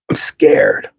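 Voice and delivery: breathy whisper of I'm scared; whispered admission. Pretend. A person admitting fear to a stranger, whispered. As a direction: confess it in a whisper. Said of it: "boldly and courageously honest".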